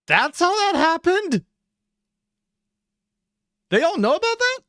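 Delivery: high, loud, incredulous question; real astonishment, pitch shooting up; shocked disbelief. Real, not acted. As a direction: blurt it out, stunned and high-pitched. Shocked reaction to played clip.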